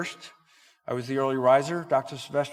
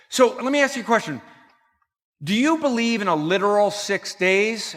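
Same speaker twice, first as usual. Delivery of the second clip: loud, raised-pitch questioning; challenging husband; 7 semitones higher, 7 dB louder than usual. Retold or imitated. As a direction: imitate a husband putting a pointed question.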